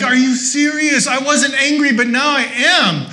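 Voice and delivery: high, loud half-laughing indignation; mock outrage. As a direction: play your own annoyance on hold for laughs. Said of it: "I'm like".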